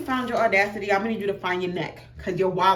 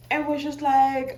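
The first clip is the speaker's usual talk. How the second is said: normal talking voice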